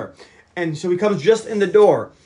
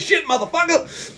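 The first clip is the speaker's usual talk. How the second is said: much higher, sharp startled curse; 11 semitones higher than usual. Retold or imitated. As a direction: blurt it out high as if just stabbed. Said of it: "He says".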